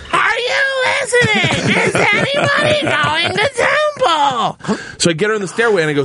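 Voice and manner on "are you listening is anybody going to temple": high, slow, screaming whine; wailing scream. Retold or imitated. Stacy screaming in the stairwell, high whiny scream. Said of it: "still screaming at the top of her lungs".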